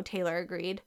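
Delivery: usual speaking voice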